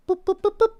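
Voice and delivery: high mock beeping boops. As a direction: chirp them out like a cartoon sound. Mock sound effect for scene.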